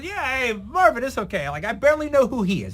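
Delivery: a hint of a breezy Sergio brushing it off; imitating Sergio. Sergio voiced in retold story.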